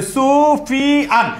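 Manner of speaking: সুফিয়ান broken slowly into su, fi, an; three separate syllables. Shown as a demonstration. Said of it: "এটা তিনবার উচ্চারণ করি". (সুফিয়ান তিন ভাগে টেনে টেনে উচ্চারণ)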